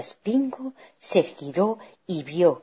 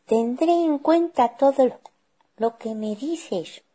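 put-on high child voice; high-pitched obedient little girl; 10 semitones higher, 3 dB louder than usual. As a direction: promise obediently as a small girl. Girl reassures her mother.

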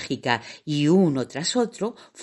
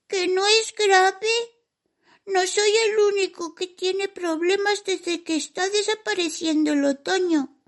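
high squeaky voice near tears; tearful mouse. Acted. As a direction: protest through tears. Distressed mouse protests.

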